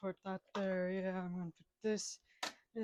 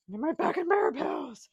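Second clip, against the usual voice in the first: higher, louder hammed-up crying plea; mock wail; 7 semitones higher, 6 dB louder than usual. Man searching for back pills, hammed-up wail. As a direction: wail it like a melodramatic invalid. Same words, plain then wailed.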